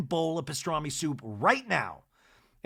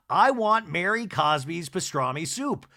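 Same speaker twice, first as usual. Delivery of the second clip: ordinary talk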